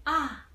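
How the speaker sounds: "ah" starting high and dropping, as in sudden understanding; falling realization. Shown as a demonstration. Teacher acts finally understanding something, falling tone. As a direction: let "ah" fall like you finally get it. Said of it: "like you finally understand something".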